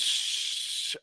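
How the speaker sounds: unvoiced shush; soft voiced hush. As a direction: shush like a menacing villain. Villain hushes in acted line.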